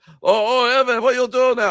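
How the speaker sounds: put-on high, loud Russian-accented wrestler voice; Nikolai voice. Retelling a prank call, high loud Nikolai voice. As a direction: mimic the old friend answering the phone. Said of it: "He's like".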